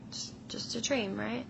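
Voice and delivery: soft whisper to calm herself; hushed self-reassurance. Retold or imitated. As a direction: whisper it like a secret comfort. Reassuring herself inside dream.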